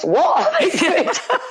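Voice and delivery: high, laughing repeat of the question; laughing disbelief. Retold or imitated. Mother retelling the family's shock, laughing and high. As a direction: throw up your hands and laugh through it.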